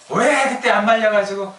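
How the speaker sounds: high, drawn-out complaint; mock reproach. Grown child blaming parents for not stopping them, whiny reproach. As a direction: wail the blame, stretching the words.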